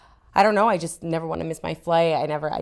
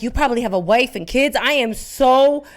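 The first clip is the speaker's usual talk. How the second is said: mortified apology; 7 semitones higher, 7 dB louder than usual. Retold or imitated. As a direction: plead forgiveness to the old man. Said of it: "I'm like".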